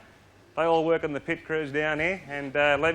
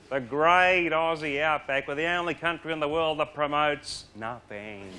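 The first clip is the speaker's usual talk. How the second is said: ordinary talk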